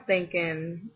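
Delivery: usual speaking voice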